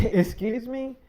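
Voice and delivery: incredulous, caught off guard; shrieked indignant question; outraged. Retold or imitated. Offended reaction in quarrel.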